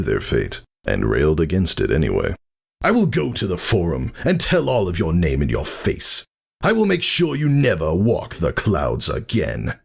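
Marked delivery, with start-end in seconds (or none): 2.8-9.8 impotent fury. A narrator's reading. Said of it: "voice thin and creaking".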